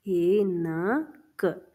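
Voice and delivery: very slow, syllables stretched; ऐनक spoken slowly, ऐ-न-क; slow dictation. Shown as a demonstration. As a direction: draw ऐनक out for the children to write.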